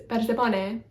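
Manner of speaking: "Persepone" with tapped r and short o; per-seh-PON-ay for "Persepone", short o; Greek pronunciation. Shown as a demonstration. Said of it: "omicron is a short O".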